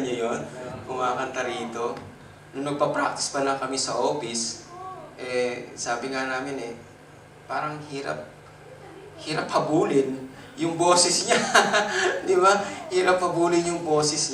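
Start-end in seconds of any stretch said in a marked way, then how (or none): none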